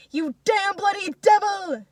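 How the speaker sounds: slow, high, loud curse; drawn-out enraged yell; furious scream. A narrator's reading. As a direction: scream each word at the animal. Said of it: "Misha screams out in a fury".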